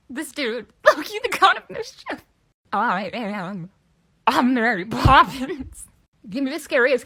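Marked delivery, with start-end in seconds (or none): 0.9-2.2 very high, loud squeal; hammed-up high-pitched exclamation; mock outcry. Exclaimed punchline in a skit. 4.3-5.9 loud, drawn-out proud shout; big slow announcement, hammed up; exclaiming. Proud shouted movie quote.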